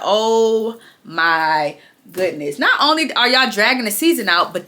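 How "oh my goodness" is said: slow, low, drawn-out sigh of disbelief; exasperated. Retold or imitated. Vlogger recalling the episode ending, slow exasperated groan. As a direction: drag it out, weary and fed up. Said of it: "I said".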